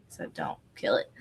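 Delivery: whispered plea; pleading quietly not to ruin it. Real, not acted. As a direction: breathe it out unvoiced. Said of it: "my all prized possession".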